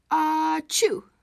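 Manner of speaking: playful high sneeze; big storybook sneeze; acted sneeze "ah-choo".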